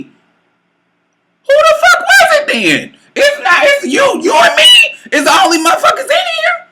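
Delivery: very high, loud screeching retort; screamed disbelief. Retold or imitated. Woman answering lover's claim it wasn't him, screaming. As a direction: shriek it at him, totally incredulous. Retold shouted retort to his denial.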